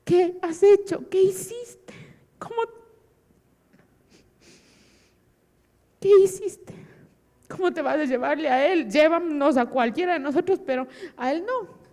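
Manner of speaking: retold wail of protest to God; anguished cry. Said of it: "gritaba y lloraba y le decía".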